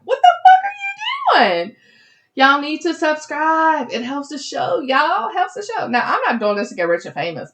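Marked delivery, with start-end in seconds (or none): 0.0-1.8 very high, loud mock-outraged squeal; mock outrage. Host scolds unsubscribed listeners in mock outrage. 2.3-5.9 high, insistent playful nagging; playful pleading. Real, not acted. Host begs listeners to subscribe, playfully pleading.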